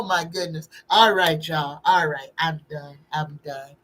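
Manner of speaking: ordinary talk, nothing marked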